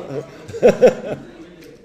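loud high burst of laughter; real loud laughter. Real, not acted. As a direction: crack up at the crowd. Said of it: "il connaît Julien".

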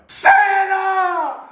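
high, far-carrying yell of "Santa!"; shouted Santa. Shown as a demonstration. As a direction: call "Santa!" out to the whole town. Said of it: "Let me show you how it's done".

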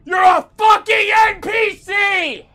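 high, very loud, drawn-out yell; real exasperated rage; furious shout. Real, not acted. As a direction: scream the insult back at the game. Yells back at game character.

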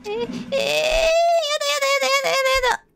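high, crying no no no; panicked whining. Real, not acted. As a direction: wail in fake panic. Panicking in scary game.

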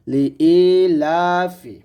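"লি ই লা ফি" chanted slowly with held long vowels; drawn-out vowels. Shown as a demonstration. Teacher reciting the example for students, long vowels held. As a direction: stretch ই and লা in "লি ই লা ফি". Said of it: "ইতে আমরা এক লিপ টান দিব".

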